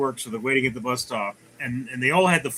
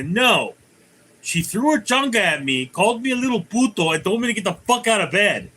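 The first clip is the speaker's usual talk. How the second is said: higher, fast scolding mom voice; mock angry mom; 6 semitones higher than usual. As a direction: rattle it off like a fed-up mother. Mother scolding son in joke.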